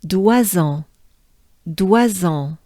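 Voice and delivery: "Doisans" as dwa-zan with a nasal end; French pronunciation. Shown as a demonstration. Teacher drilling "Doisans" syllable by syllable. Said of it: "we have to add".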